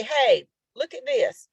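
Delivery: high, slow, eager call; excited headline read. Shown as a demonstration. Teacher demos an excited ad headline, eager and high. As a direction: sell it like an attention grabber. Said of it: "So you wanna say".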